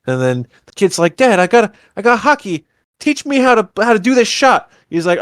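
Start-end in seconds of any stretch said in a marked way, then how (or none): 1.1-4.7 high-pitched imitation of an eager child; pleading kid. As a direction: play the kid begging Dad. Voicing the kid asking Dad.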